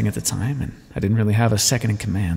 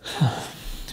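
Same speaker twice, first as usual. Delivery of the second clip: soft, breathy sigh; quiet exhaled sigh; 8 dB quieter than usual. Acted. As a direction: let out a quiet, voiceless breath. Weary pause in acted monologue.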